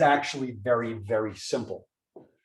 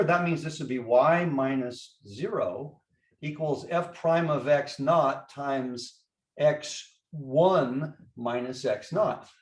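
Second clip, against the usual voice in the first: normal talking voice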